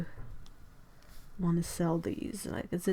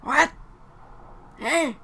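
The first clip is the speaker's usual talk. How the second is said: put-on old man voice; 7 semitones higher than usual. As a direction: play a grumpy old man. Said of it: "I can do the old man sounds".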